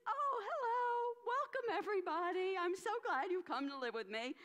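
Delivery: high, bright-hearted greeting; cheery welcome. Acted. Hermit greeting the demons in his cave, overjoyed. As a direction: welcome them like beloved guests.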